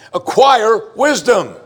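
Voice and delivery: ACQUIRE WISDOM very high, loud and slow; "acquire wisdom" exclaimed with both words stretched; insistent repeated command. Acted. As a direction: hammer "acquire wisdom" as the oft-repeated refrain. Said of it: "here we go again".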